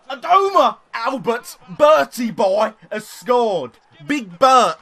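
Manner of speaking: high, loud, stretched-out goal yell; goal celebration. Real, not acted. Gamer celebrating his winger's goal, loud excited yell. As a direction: scream it like a commentator.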